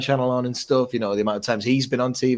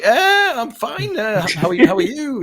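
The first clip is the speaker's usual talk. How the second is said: very high, squeaky awkward reply; hammed-up strained politeness, pitched way up; awkward greeting back; 14 semitones higher, 3 dB louder than usual. Replying to a woman who greeted him, awkwardly.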